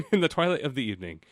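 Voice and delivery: ordinary delivery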